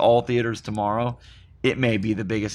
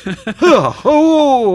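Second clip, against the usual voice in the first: high, loud grossed-out ugh; disgusted groan; 11 semitones higher, 8 dB louder than usual. Real, not acted. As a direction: recoil in disgust. Disgust at raw rhubarb taste.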